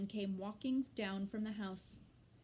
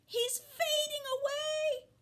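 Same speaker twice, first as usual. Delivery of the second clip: put-on alarmed girl; 7 dB louder, slower than usual. A narrator's reading. Fern alarmed about Wilbur.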